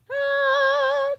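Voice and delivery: loud, very high held "ah"; high sung exclamation. Real, not acted. Vlogger revealing the comic to viewers, high sung "ah". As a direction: sing out a high "ah". Excited gasp-singing over comic.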